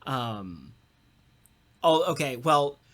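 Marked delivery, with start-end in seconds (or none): none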